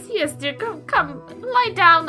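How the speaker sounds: ordinary talk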